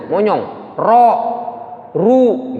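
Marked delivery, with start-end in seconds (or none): 0.8-1.1 Ro with rounded pushed-out lips; heavy tafkhim Ro; lips forward ro. Shown as a demonstration. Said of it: "itu artinya bibirnya maju".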